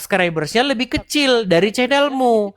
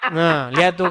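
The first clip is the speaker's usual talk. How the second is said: usual speaking voice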